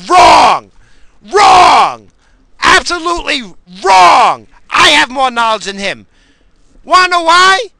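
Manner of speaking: high, loud, drawn-out shouting; furious rebuttal. Real, not acted. Lab worker contradicting the politician's fans, furious shouting. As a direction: shout them down, leaning hard on each key word.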